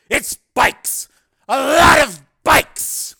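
high, loud cry of alarm; excited shout. A narrator's reading. Hardesty shouting over the approaching roar. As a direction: call out the incoming bikes at full volume. Bikes roaring into the airport.

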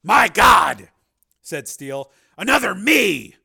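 loud, high gasp of disbelief; astonished shout; shocked exclamation. Acted. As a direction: cry out in shock. Shocked at another self.

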